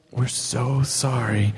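slow, low, drawn-out sorry; imitated regretful rejection; mock apology. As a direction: let them down with exaggerated sympathy. Retells organizers' apologetic rejection.

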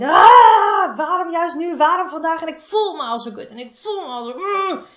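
loud, high-pitched wail of frustration; acted overwhelmed parent losing it; exasperated outburst. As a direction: burst out fed up, ending in a strangled groan.

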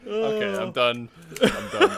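cracking up, giving up the bit; laughing as he quits the ASMR joke. Real, not acted. Breaks off laughing.

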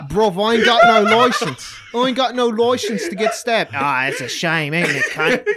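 put-on loud, high British roadman accent; mock British accent. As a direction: play a London bloke in a thick British accent. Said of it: "He has to do the accent".

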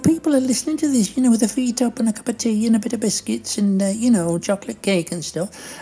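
high-voiced Doris fussing about the noise; high, put-upon grumble as Doris; Doris, complaining. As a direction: scold the host in a high, chatty voice.

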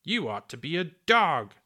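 disgusted. A narrator's reading. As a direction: throw it back in disgust.

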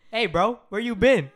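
loud, high casual bro greeting; loud high street-style hey bro. Shown as a demonstration. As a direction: greet a basketball buddy, loose and loud. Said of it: "I go".

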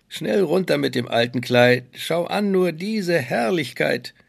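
put-on sly, high old peddler woman; evil queen. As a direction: coax her like a scheming witch. Disguised queen urges.

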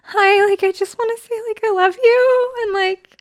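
high mock fan voice; gushing fan girl. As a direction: play the girl gushing at a celebrity. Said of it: "don't be the girl who's like".

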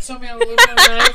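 high, loud mock impression. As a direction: mimic her teasingly. Mocking her, high loud far voice.

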